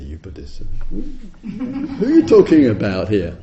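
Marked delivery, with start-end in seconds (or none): 0.0-0.6 low, soft, disdainful "You Buddhists"; mock accusation. Teacher relishing a visitor's jab, low and soft. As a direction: savour the jab quietly. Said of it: "I love it when they say".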